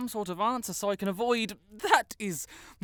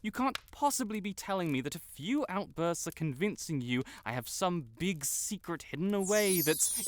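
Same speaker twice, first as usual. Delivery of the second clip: ordinary talk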